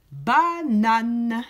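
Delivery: «Banane» said loud and carefully; clear model word. Shown as a demonstration. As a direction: articulate «Banane» slowly for the children. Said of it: "on dit juste les mots". («Banane» articulé fort et clairement)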